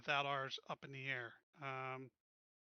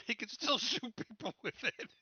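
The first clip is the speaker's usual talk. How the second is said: laughing through the words, pitched up; 7 semitones higher, 5 dB louder than usual. Real, not acted. Player joking to the group about shooting people, laughing. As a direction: chuckle it out, amused by the idea.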